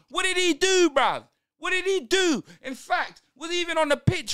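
loud, high demand at the referee; outraged protest. Real, not acted. Fan protesting a booking to the referee, loud and outraged. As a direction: throw the question at the ref.

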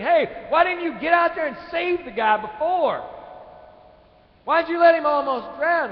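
high, very loud mock scolding; ridiculing shout. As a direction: berate him like an indignant crowd. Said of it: "they start ridiculing".

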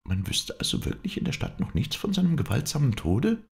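high-voiced, probing question; wife incredulously seeking confirmation; anxious wife. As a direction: press the visitor in a high voice.

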